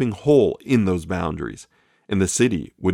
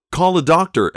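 high, urgent cry; frantic plea for a doctor; cried out; 8 semitones higher, 4 dB louder than usual. A narrator's reading. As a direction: call out in panic over the baby. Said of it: "Evelyn cried".

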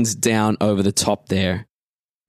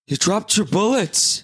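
bad stage whisper; 10 semitones higher than usual. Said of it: "a really terrible stage whisper of".

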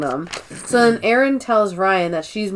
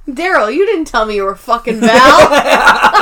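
loud, oblivious blurting; imitated shouting; 3 semitones higher, 8 dB louder than usual. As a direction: shout it out across the room, mimicking a tactless coworker. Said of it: "He just shouts that shit out".

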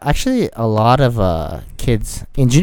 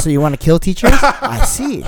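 mock guessing; 9 semitones higher than usual. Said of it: "Oh, so".

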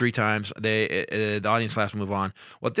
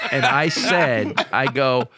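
usual speaking voice

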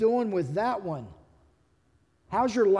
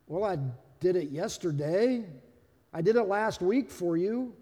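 softer, tentative reply with rising endings; meek rebuttal; 3 semitones lower, 4 dB quieter than usual. Acted. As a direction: answer the accusation in a small, questioning voice. Acted meek reply to accusation.

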